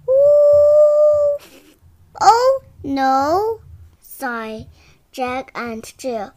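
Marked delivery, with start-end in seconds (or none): 0.1-3.6 dismayed groan. Acted.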